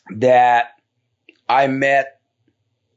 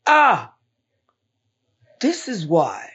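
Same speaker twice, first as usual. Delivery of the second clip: retold sudden realization; 4 semitones higher, slower than usual. Guest recalls seeing why his community struggles, retold realization. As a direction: re-enact the moment it all made sense.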